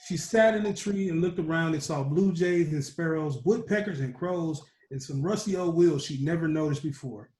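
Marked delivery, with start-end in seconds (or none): none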